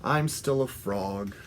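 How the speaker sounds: low, deflated drop; mild acted letdown; disappointed. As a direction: let all the hope go out of it. Frog disappointed magic failed.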